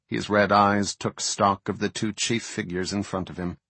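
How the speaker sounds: usual speaking voice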